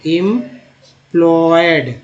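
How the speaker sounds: एम्प्लॉयड very slow, split after "em"; broken into parts. Shown as a demonstration. Teacher joining em to the rest, एम्प्लॉयड drawn out in parts. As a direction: stretch each part of एम्प्लॉयड. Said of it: "तोड़ तोड़ के कैसे पढ़ेंगे".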